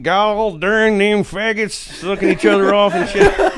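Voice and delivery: laughing mock hillbilly bigot. Mocking a hateful bigot.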